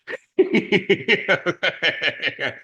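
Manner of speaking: hearty loud burst of laughter; big laugh. Real, not acted. Speaker laughs at his wife's teasing retort, loud hearty laugh. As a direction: let a real laugh break out.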